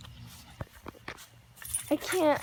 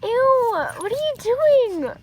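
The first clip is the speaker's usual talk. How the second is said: higher, louder grossed-out question; disgusted high outcry; 8 semitones higher, 6 dB louder, slower than usual. Real, not acted. Girl grossed out by her dog: high disgusted outcry.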